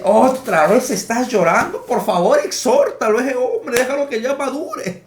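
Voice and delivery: high-pitched, mock-exasperated pleading; exasperated complaint. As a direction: act out an old complaint, voice jumping up. Acts out his old exasperated complaint. (queja exasperada, imitada con voz aguda)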